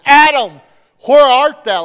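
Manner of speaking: loud, high call across the garden. Acted. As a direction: call out loudly for someone hiding. God calling out to Adam.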